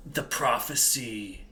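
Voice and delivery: low, slow, seductive voice from nowhere; dark sexy disembodied voice. Acted. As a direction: purr it from the shadows. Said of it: "this dark and sexy disembodied voice".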